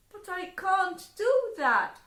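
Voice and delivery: put-on high, loud pleading girl's voice; high, loud girl protesting. Prezzemolina protests.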